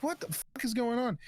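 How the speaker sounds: high alarmed retelling of dad; dad asking what is happening, high alarmed. Retold or imitated. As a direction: rush the question in a panic. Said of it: "and he's like".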